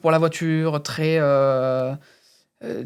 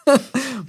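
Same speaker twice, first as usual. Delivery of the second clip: short laugh; 10 semitones higher, 4 dB louder than usual. Real, not acted. Laughs at own accent.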